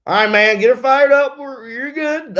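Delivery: high, loud pumped-up radio call; hyped spotter. Retold or imitated. Spotter on the radio urging driver, high loud hype. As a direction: fire up the driver from the spotter stand.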